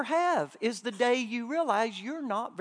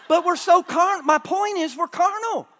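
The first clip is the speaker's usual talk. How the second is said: preaching at full force; 9 semitones higher, 6 dB louder than usual. Acted. Preacher pressing the point hard.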